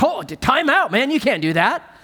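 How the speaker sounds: scandalized scribes. Retold or imitated. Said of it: "the scribes are like".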